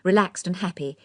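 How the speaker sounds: usual speaking voice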